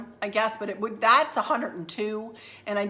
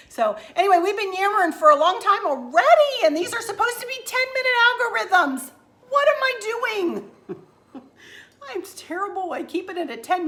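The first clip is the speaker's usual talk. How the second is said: much higher and louder, rushing; flustered; 10 semitones higher, 6 dB louder than usual. Real, not acted. Vlogger scolding herself for running long, flustered and high. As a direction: throw up her hands at herself.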